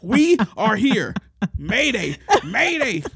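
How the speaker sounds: high, very loud distress call; mock panicked shout. Teller reliving losing it on the ride, panicked shouting. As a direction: holler it like a hammed-up emergency call.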